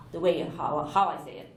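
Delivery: ordinary talk, nothing marked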